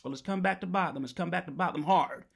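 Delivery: normal talking voice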